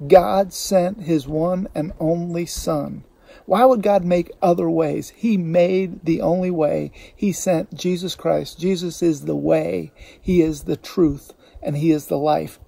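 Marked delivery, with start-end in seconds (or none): 0.1-11.3 slow emphatic preaching. Real, not acted.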